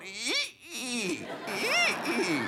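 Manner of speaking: thin squeaky rope creak, voiced high; creaking rope noise. Preacher imitating the rope creaking high above the boat, squeaky. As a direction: mimic the rope straining, ee ee. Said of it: "All I hear is that rope going".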